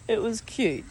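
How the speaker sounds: normal talking voice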